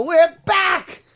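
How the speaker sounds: rock star shout. Retold or imitated. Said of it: "I, I go".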